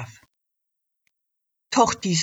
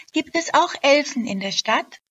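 curious little elf; 9 semitones higher, 4 dB louder than usual. Acted. Elf asks the elk.

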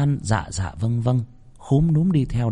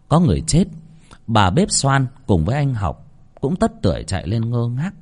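ordinary delivery

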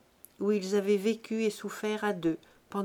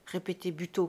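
ordinary delivery

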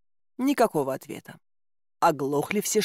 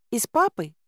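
put-on high child's questioning voice; маленькая царевна; 5 semitones higher than usual. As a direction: ask hopefully as a little girl. Царевна спрашивает о папе. (высокий детский вопрос)

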